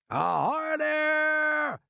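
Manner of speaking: high, long-drawn holler; hailing shout. A narrator's reading. Police chief hailing the strangers up on the peach, shouting. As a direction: hail someone far above you. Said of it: "shouted the chief of police".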